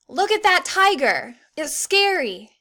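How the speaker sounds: teacher modelling an excited exclamation; exclaiming. Shown as a demonstration. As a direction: sell it like you just spotted a real tiger. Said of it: "An exclamation mark at the end of an exclamatory sentence".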